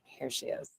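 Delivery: breathy hush, barely voiced; whispering. Real, not acted. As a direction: whisper it like you're peeking in. Sneaking a look at her stream.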